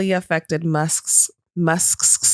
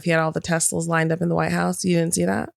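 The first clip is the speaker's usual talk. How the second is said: ordinary talk, nothing marked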